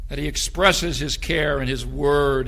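loud, soaring pulpit voice; fiery preaching. Acted. Pastor exhorting the church about God's care: fiery preaching. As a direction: lift the congregation with full force.

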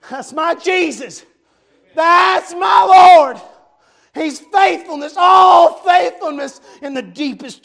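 loud, fervent revival preaching; impassioned sermon delivery; preaching at full force. Real, not acted. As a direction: preach it to the rafters, building to shouts. Preacher reading and preaching Revelation.

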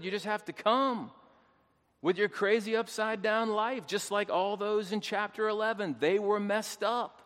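high, loud pulpit exhortation; full-force preaching. Real, not acted. Preacher telling listeners to just come as they are, full-force preaching.

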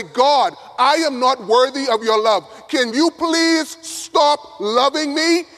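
high, impassioned plea, slowing at the end; pleading prayer. Acted. Unworthy student on bowed knees begs God, pleading prayer. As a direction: beg God with rising urgency. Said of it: "if you were to bow your knees".

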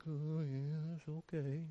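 low, very soft, droopy mumble of mock gloom; Eeyore. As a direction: sound half-heartedly okay, dragged down and glum. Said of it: "not having the Eeyore mentality".

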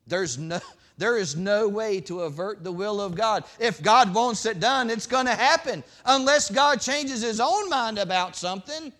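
loud, high preaching voice; full-force pulpit preaching. Acted. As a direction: preach it hard to the congregation.

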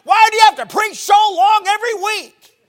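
very high, loud shout; shouted complaint. Retold or imitated. Member complaining about long preaching, shouting. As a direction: holler it at full force.

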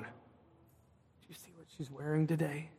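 hushed, catty aside; a teen's hushed gossip; gossipy hush. Retold or imitated. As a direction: lean in and dish it quietly. Imitating gossiping high schoolers.

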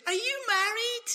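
put-on very high, loud girlish falsetto. Starbucks girls asking the Scottish minister about marriage, high girlish falsetto. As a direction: mimic smitten girls in a squeaky voice.

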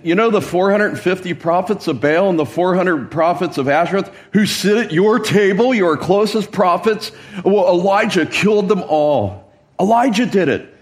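spineless Ahab tattling. Acted. Spineless king reports to Jezebel on her prophets, tattling. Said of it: "so you picture the scene. Here comes Ahab".

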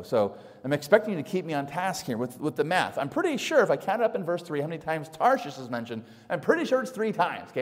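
ordinary talk

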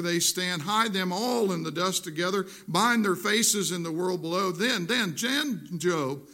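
loud, rising scripture proclamation, building; preaching at full force. Acted. Pastor proclaims God's words to Job, preaching at full force. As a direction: thunder God's challenge to Job from the pulpit.

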